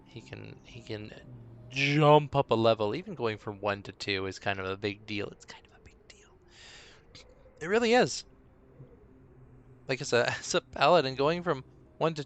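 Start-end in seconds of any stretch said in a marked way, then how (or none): 5.3-6.3 quick, barely audible whisper; hushed, confiding whisper; whispered aside. Real, not acted. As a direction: repeat it under your breath. Hushed repeat of own remark.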